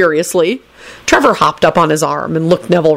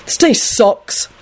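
slow, higher-pitched moan; moaning complaint; 7 semitones higher, much slower than usual. A narrator's reading. Neville grumbles to Alice before opening the door, moaning. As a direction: drag it out in complaint. Neville moans about his day.

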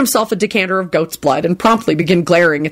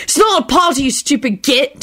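higher, irritated snap at a fool; impatient; 7 semitones higher than usual. A narrator's reading. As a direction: brush him off impatiently. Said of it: "Tim said impatiently".

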